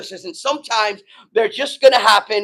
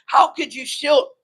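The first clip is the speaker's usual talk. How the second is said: usual speaking voice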